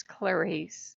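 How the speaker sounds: lightly voiced movie villain; a hint of a creepy killer's measured voice; Hannibal Lecter. Mimicking the guy she watched, lightly Hannibal-like. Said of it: "looked like a fat Hannibal Lecter".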